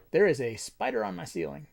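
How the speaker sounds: normal talking voice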